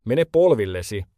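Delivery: ordinary delivery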